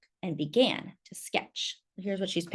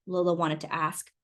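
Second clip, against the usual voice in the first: ordinary delivery, nothing marked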